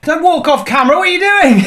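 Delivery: high, loud calling after him; shouting after someone who walks off; loud, high-pitched disbelief; alarmed call. Real, not acted. Co-host walks away in pain.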